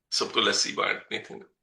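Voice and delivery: ordinary talk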